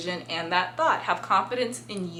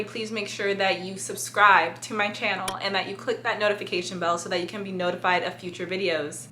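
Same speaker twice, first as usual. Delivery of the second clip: usual speaking voice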